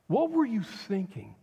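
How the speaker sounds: bewildered, indignant demand for an answer; exasperated accusing question. Retold or imitated. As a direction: throw the question up at God in exasperation. Repeated accusing question to God.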